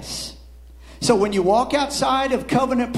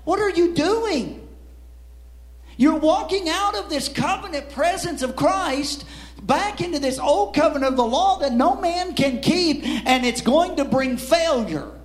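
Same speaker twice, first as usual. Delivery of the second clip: Paul; 7 semitones higher than usual. Acted. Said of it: "And Paul is saying to them".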